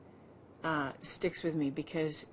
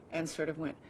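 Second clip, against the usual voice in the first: ordinary delivery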